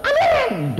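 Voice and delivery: high, loud exultant amen. Retold or imitated. Young man answering God with a loud joyful amen. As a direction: shout the amen. Joyful response to God's word.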